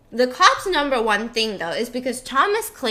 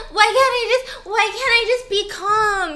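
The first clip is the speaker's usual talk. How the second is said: mock hysterical plea; 9 semitones higher, 5 dB louder than usual. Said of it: "Yeah, and like".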